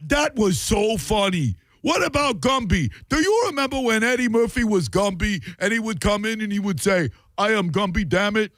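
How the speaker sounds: high, hammed-up thick New York accent; mocking caller's accent. Host imitates a caller reminiscing over old sketches, thick New York accent. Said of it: "thick New York, barely decipherable accents".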